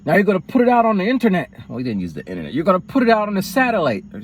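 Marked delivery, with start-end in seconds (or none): 0.0-1.5 imitating dad. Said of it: "But then my dad's like". 2.5-4.0 imitating dad. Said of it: "But then my dad's like".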